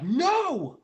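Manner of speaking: high, loud cry of no; desperate shout. Retold or imitated. Watching Jet Ray take her away, a loud desperate no. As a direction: yell it as she is carried off. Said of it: "I'm like".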